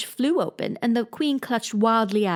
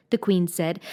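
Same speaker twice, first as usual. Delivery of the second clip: plain reading voice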